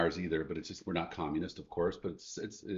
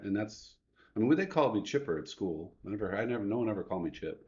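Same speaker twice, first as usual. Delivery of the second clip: normal talking voice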